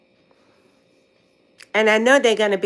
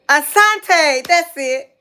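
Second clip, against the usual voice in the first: excited remembering; 7 semitones higher, 5 dB louder, much slower than usual. Real, not acted. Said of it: "And see, other".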